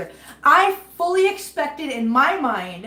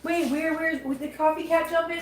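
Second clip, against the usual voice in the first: normal talking voice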